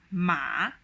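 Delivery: "ma" pressed down low, then bounced up; third tone. Shown as a demonstration. Teacher demonstrating the hardest tone, "ma" dipping then rising. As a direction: dip deep on "ma" and rise out of it. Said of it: "The third tone will sound something like".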